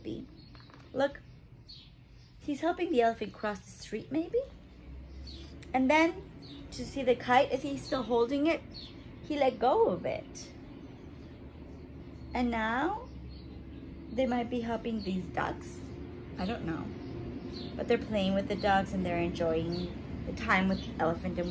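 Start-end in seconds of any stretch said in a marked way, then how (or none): none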